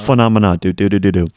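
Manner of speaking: loud, low-pitched sing-song outburst; playful booming chant; really loud. Real, not acted. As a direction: belt it out low with silly nonsense syllables. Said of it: "That was really loud".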